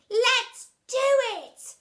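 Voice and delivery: put-on high, loud, slow chorus of boys; boys replying. Class answering teacher, slow high chorus. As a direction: sing it back as a gang of eager kids. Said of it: "they all replied".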